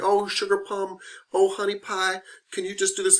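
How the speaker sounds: high, sugary Southern-lady coo; imitating an older coworker calling everyone sugar plum; sweet older lady. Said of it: "They're like".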